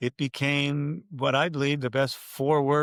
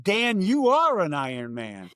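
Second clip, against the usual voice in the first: slow, higher announcer's proclamation; retold finish-line call; 4 semitones higher than usual. Announcer to his friend crossing the finish line, proclaiming. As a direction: stretch it out like a race announcer. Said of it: "and I yell out".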